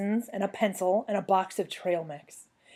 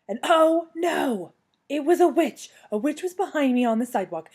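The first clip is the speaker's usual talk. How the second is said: higher, louder panicky exclamation; alarmed; 7 semitones higher, 6 dB louder than usual. A narrator's reading. Child spotting a witch behind her, alarmed. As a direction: blurt it out in storybook alarm.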